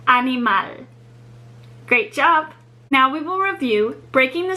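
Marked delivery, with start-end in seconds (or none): 0.1-0.9 "Animal" in Spanish, MAL stressed and held; stress on final syllable. Shown as a demonstration. Teacher showing the final-syllable rule, stress on MAL in "Animal". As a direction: lean on the last syllable of "Animal". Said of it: "final syllable is what is stressed".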